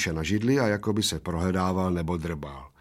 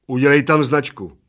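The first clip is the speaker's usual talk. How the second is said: commanding; 5 semitones higher, 8 dB louder than usual. Acted. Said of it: "poručil Plešatec".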